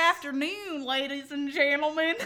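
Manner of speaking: badly faked Australian accent; Australian attempt. Shown as a demonstration. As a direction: greet an audience in amateur Australian. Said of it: "That's my Australian accent".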